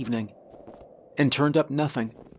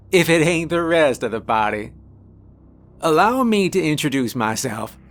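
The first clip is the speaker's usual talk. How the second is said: louder, higher Southern drawl; hard Southern drawl; 4 semitones higher, 5 dB louder than usual. A narrator's reading. Man in charge greets the returning party, hard Southern drawl. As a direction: boom it out with a hard Southern twang. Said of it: "said in a hard Southern drawl".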